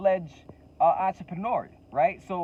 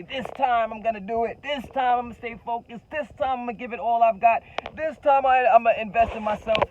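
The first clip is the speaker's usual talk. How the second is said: mock resolve; 8 semitones higher, 6 dB louder than usual. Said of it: "And then now they say".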